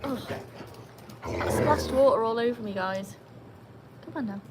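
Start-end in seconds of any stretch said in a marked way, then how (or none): none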